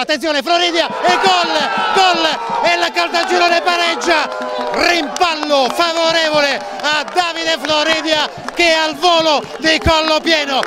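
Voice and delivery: loud, high goal shout; breathless italian goal commentary. Real, not acted. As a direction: scream the goal out. Equaliser called live.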